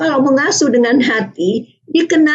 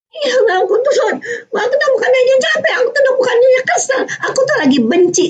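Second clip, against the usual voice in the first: put-on much higher, tearful child pouring it out; crying child voice; 12 semitones higher, faster than usual. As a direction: cry out like a hurt child who finally admits it. Child voiced venting feelings.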